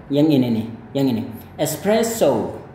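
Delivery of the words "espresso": espresso drawn out slowly, showing the final o; slow espresso demo. Shown as a demonstration. Teacher slowly models espresso's ending. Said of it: "bunyinya O pada akhirnya".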